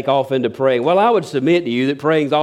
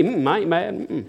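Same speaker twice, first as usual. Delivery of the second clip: quiet pouting mm, I ain't mad; sulky denial; 6 dB quieter than usual. Retold or imitated. Spouse pouting at partner who asks, sulky mumble. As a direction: hum it shut, sulking. Sulking spouse denies anger.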